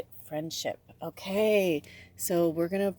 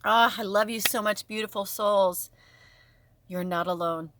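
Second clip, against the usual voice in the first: ordinary talk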